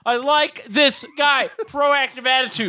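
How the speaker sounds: hammy approving voice. Pretend. Repeats line in put-on voice.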